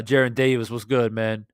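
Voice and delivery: usual speaking voice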